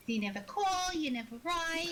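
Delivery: guilt-trip it in a Jersey accent. Shown as a demonstration. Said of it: "practicing my Jersey accent for her".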